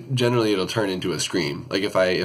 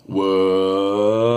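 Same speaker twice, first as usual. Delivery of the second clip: note breaking into scream; 5 dB louder than usual. Shown as a demonstration. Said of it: "So it sounds like".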